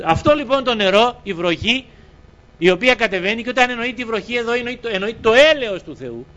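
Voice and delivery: high, emphatic sermon voice; impassioned preaching. Real, not acted. Preacher likens rain to God's word, impassioned. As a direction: proclaim it to the congregation, pitched high.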